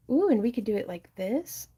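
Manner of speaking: bright rising ooh, new idea; light up at the idea; excited planning. Real, not acted. Sudden idea for the clay.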